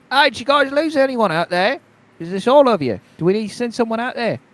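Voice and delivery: a hint of worried guard. GM voices guard.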